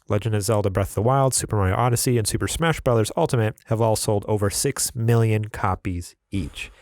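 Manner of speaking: ordinary talk, nothing marked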